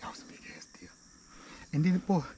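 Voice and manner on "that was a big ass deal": whisper. Real, not acted. Shaken hunter whispering after shot.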